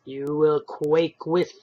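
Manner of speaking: very slow, monotonous menace; slow, harsh golem voice; golem's threat. Acted. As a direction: drag it out darkly. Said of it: "said the stranger".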